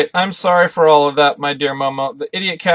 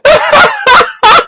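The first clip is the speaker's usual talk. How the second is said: laughing; 10 dB louder, much slower than usual. Real, not acted.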